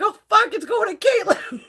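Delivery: high, loud, panicked cry; alarmed outburst. Retold or imitated. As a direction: blurt it out in sudden alarm. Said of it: "I'm like".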